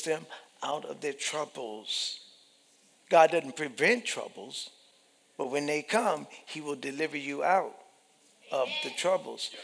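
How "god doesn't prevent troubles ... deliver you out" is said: usual speaking voice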